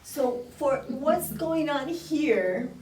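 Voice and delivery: ordinary talk, nothing marked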